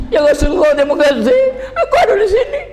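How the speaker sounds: high, crying voice pleading to God; sobbing thanks in prayer; tearful prayer. Retold or imitated. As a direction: weep out thanks at the Kaaba. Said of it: "aku nangis".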